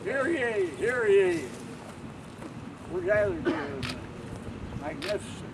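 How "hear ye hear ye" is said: booming proclamation call; town crier. Acted. Town crier opening ceremony.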